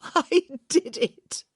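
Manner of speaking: slow, high, loud laughing cry; crazed laughing. A narrator's reading. Hyacinth to Gareth, slow wild laughing cry. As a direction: drag it out, giddy and wild. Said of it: "She laughed, almost crazily".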